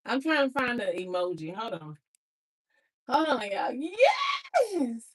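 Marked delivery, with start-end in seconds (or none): 3.1-5.0 excited celebration. Real, not acted. Celebrating praise while replying to it.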